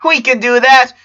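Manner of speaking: put-on two excited little girls; eager twins. A narrator's reading. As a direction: jump at the chance to play cops. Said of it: "said both twins".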